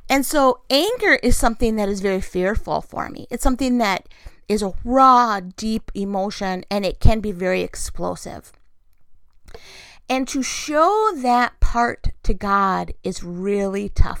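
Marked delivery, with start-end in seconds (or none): none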